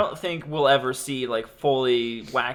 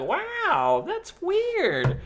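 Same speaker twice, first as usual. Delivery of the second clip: dazed wow; much slower than usual. Retold or imitated. Retold dazed realization.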